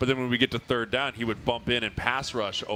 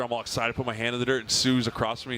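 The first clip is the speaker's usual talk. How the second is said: normal talking voice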